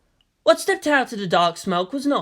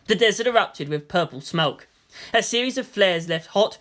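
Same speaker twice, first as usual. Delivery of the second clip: ordinary delivery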